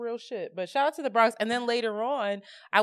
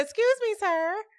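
polite call; 11 semitones higher than usual. Retold or imitated. Polite call to stranger at car.

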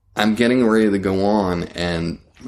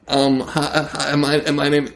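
nervous Bobcat; 6 semitones higher than usual. Retold or imitated. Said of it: "and he's like".

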